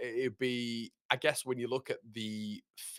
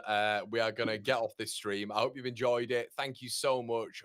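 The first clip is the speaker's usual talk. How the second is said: ordinary talk, nothing marked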